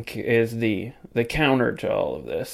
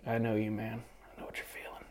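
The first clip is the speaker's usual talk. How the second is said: hushed inner voice; 16 dB quieter, faster than usual. Retold or imitated. Fan imagines silent exchange.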